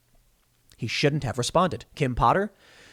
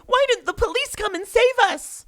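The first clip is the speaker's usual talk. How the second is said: mocking protesters.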